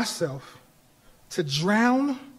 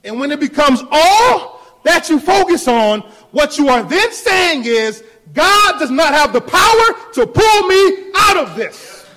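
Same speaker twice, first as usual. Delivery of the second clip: loud, rising preacher's build-up; full-force preaching; 6 semitones higher, 9 dB louder than usual. Acted. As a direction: press the point harder on every phrase.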